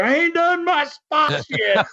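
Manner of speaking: high, drawn-out mock yell; retold screaming. Son imitating dad still screaming amid the riot, high mock scream. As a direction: imitate dad hollering from far back, held-back volume. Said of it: "Dad's still screaming".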